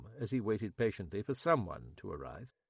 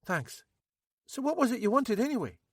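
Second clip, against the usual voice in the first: higher, louder nervous local man; Max; 13 semitones higher, 4 dB louder than usual. A narrator's reading. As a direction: play Max high and jittery. Max voiced.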